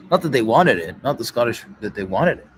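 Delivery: ordinary talk, nothing marked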